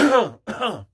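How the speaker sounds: forced loud throat-clearing cough; loud fake cough. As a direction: fake a cough to make noise. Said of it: "like, cough loud".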